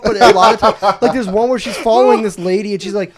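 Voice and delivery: ordinary delivery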